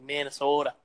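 "Minnesota" with a long, leaned-on O; Minnesota accent. Retold or imitated. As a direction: stretch the O in "Minnesota", mock Minnesotan. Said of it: "they gotta emphasize the other".

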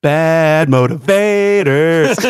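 loud, high, very slow call-out; big hammed-up holler; drawn-out announcement. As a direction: stretch it out like a show announcer. Calls out the show's phrase.

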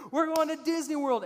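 high, loud shout of big news; excited dad's reveal. Acted. Dad reveals the surprise to kids, excited shout. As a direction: announce it like a hyped-up dad. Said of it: "like, so excited".

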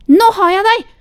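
loud, high triumphant shout; gleeful shout. A narrator's reading. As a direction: yell it out like you've caught her. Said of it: "Ropte Sofia".

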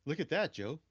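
casual mock of coworker pointing; imitating Angel. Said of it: "You just see Angel like".

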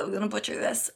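ordinary delivery

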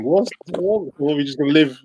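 normal talking voice

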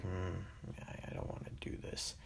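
very soft, half-whispered imitation of reluctance; imitated hushed, hesitant grumble; reluctant mumble. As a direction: act out not wanting to be there, barely above a whisper. Voicing Pam's silent reluctance.

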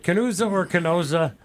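"Canusa" with oo, then "Canosa" with oh; two pronunciations contrasted. Shown as a demonstration. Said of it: "whether you pronounce it".

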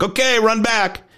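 high, slow shouted order from the instructors; mock instructor yelling across the sand; called-out command. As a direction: call it out like a drill instructor down the beach. Instructors calling the runners back.

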